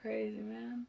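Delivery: soft unvoiced whisper. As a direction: breathe the words out. Whispered echo.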